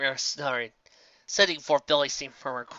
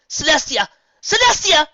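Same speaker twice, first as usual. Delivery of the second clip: high desperate shout, much louder; crying out; 11 dB louder than usual. A narrator's reading. Nightmare Moon shakes Celestia, shouting her name in panic. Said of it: "she cried out".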